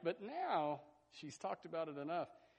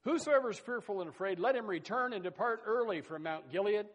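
raised preaching read of scripture; 4 semitones higher, 4 dB louder than usual. Acted. Preacher reads Gideon's call to the fearful, raised. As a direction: declaim the verse to the congregation.

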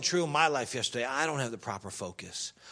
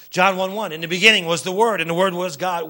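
raised, loud Bible quoting; emphatic, pitched-up preacher voice; forceful scripture preaching; 4 semitones higher, 11 dB louder than usual. Real, not acted. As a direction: proclaim the verse to the congregation.

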